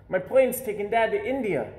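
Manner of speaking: put-on a kid showing off his paper plane; eager call. A narrator's reading. Boy shows Mom his plane game, calling out excitedly. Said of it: "I call".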